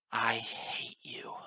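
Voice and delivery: very slow, drawn-out hatred through gnashed teeth; seething through tears. A narrator's reading. Narrator staring up at the goddess as tears run, seething. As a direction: seethe it out, held back. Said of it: "gnashing my teeth as tears ran down".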